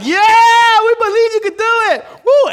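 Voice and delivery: very high, loud, eager shout; cheering crowd. Acted. Crowd answers tightrope walker, wildly excited. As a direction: whoop like a thrilled audience.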